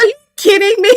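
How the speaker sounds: high incredulous squeal; mock disbelief. Watching the show, squealing in disbelief. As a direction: act stunned, pitch shooting up. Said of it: "I was like".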